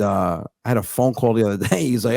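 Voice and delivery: usual speaking voice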